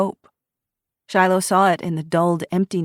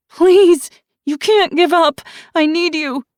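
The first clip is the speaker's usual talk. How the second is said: much higher, louder begging cry; desperate begging; 12 semitones higher, 9 dB louder than usual. A narrator's reading. Ruby begging her mother not to give up, desperate. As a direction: beg through panic. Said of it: "Ruby begged".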